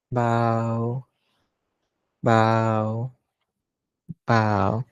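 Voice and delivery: long, drawn-out intoned call; ceremonial bow call. Real, not acted. Leading everyone to pay respect to a monk, intoned. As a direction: stretch the single word, unhurried and held. Calling bows to teaching monk.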